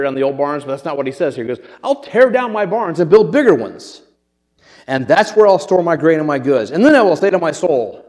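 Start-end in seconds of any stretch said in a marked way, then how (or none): 1.8-8.0 loud, hammed-up boasting; overly dramatic. Preacher acting the rich man planning bigger barns, over-the-top. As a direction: declaim it high and loud, milking each phrase. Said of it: "He's a little overly dramatic".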